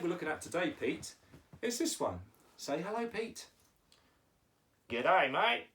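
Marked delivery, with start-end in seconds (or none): 4.9-5.7 louder, hammed-up Aussie greeting; put-on Australian with a rising end; Australian accent. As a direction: greet like a broad Australian puppet. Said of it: "you're going with the Australian accent".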